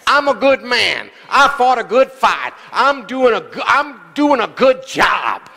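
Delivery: preached affirmation, slowing into a drawn-out shout; emphatic self-affirmation. Shown as a demonstration. Preacher showing caller what to tell himself, emphatic declaration. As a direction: declare it boldly, building to a shout. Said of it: "you better start saying it to yourself".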